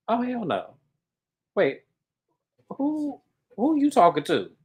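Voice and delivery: high-pitched, halting disbelief; acted indignation. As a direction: replay an affronted reaction with pauses between outbursts. Acts out own indignant reaction.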